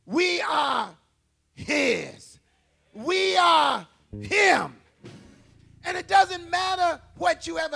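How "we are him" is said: slow, loud, weighty climax; slow preached declaration. Real, not acted. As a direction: let each word land.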